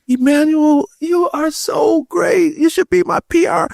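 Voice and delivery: very high, drawn-out praise; high-pitched mock gushing; gushing teacher. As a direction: imitate an adoring lady, pitch way up. Admiring teacher gushing, retold.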